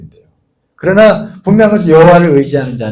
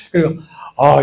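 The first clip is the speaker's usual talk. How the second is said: usual speaking voice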